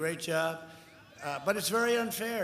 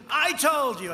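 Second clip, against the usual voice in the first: higher, louder insistent protest, retold; mock screaming interviewer; 6 semitones higher, 6 dB louder than usual. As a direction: imitate her yelling back at him. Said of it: "Where she's screaming".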